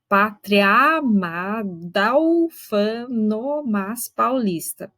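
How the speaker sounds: very slow, vowels merged at the joins; slow metrical reading; slow scansion. Shown as a demonstration. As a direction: stretch out the verse to count its syllables. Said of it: "Então, fica".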